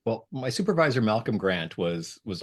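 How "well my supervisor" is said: ordinary talk, nothing marked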